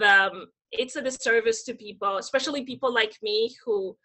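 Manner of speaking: normal talking voice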